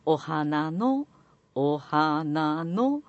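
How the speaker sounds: 「お花の」 drawn out very slowly, syllable by syllable; slow pitch demo. Shown as a demonstration. Coaching an actor, drawing out 「お花の」 very slowly. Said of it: "次、おは下げます。".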